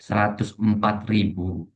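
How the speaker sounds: "Seratus empat ribu" read out slowly and evenly; measured teacher's demonstration of "Seratus empat ribu"; slow corrective reading. Shown as a demonstration. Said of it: "Kurang tepat ya".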